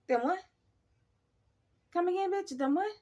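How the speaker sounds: high, loud disbelieving repeat; shocked them what. Retold or imitated. To coworker who mentions ghosts, high loud disbelief. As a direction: squawk it back in disbelief.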